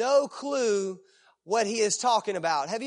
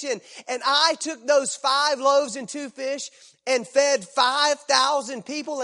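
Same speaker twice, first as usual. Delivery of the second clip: loud, urgent sermon delivery; forceful preaching; 3 semitones higher, 4 dB louder than usual. Acted. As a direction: drive it home from the pulpit.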